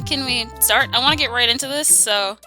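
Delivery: usual speaking voice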